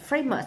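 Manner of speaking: "famous" stressed on "fa"; first-syllable stress. Shown as a demonstration. Teacher marking word stress, "famous" stressed on the first syllable. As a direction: put the weight on FA in "famous". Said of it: "là nhấn âm một".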